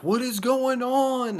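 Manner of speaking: high startled voice, slowed down; high, worried wife's voice; wife's alarmed question. Retold or imitated. Wife startled, seeing him crying.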